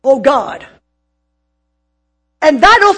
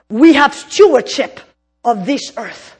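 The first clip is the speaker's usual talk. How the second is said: usual speaking voice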